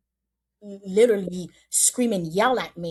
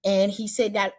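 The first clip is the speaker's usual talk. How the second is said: ordinary talk, nothing marked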